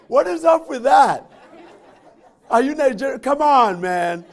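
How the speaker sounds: playfully outraged complaint, loud; mock outrage.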